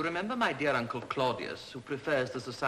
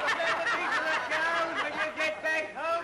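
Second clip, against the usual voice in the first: giggling taunt; 4 dB louder than usual. Acted. Children keep taunting uncle.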